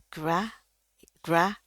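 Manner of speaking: "Gra" with a wide English æ. Shown as a demonstration. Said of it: "A ini nggak ada di bahasa Indonesia". ("Gra" dengan bunyi æ lebar)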